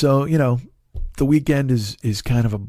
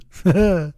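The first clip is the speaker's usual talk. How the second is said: laugh; 5 semitones higher than usual. Real, not acted. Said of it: "laughs after impression".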